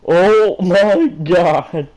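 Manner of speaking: high, loud, slow drawn-out scream; hammed-up horrified wail; shocked shriek. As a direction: shriek the words out in horror. Weiss shocked line acted.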